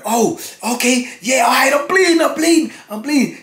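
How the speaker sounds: high panicked cry, I'm bleeding; mock frightened shout; imitated panic. Voicing injured man in cell.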